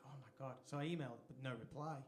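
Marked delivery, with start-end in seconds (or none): 0.1-0.6 hushed, whispered panic to oneself; whispered dread. Retold or imitated. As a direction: whisper it under your breath, dismayed. Acted private dread, stuck in house.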